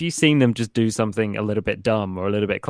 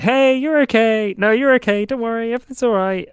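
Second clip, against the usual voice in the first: exaggerated parent voice, much higher than usual; imitated reassurance; 13 semitones higher, 3 dB louder than usual. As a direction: cheerily reassure a fallen child. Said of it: "just being like".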